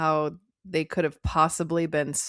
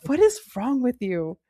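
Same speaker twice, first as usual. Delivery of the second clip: higher, incredulous question; mock exasperation; 6 semitones higher than usual. Host reacting to the movie hero's red flag, mock exasperated. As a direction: act baffled by the character's nonsense.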